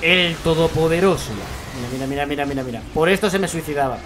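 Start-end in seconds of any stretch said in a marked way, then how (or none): 0.0-1.9 very slow, solemn mock announcer; epic announcer voice. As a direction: intone it like an epic trailer. Mock-hailing the lag.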